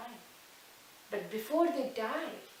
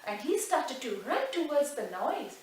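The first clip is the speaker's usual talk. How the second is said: ordinary delivery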